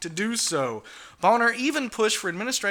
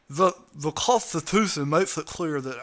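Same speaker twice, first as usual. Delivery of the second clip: lisping Boehner impression; Daffy Duck lisp; 4 semitones lower than usual. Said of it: "I can't talk like Daffy Duck".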